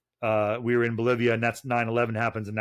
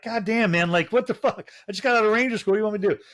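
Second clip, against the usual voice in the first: exasperated outburst; 9 semitones higher, faster than usual. Retold or imitated. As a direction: throw your hands up, high and incredulous. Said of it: "And I'm like".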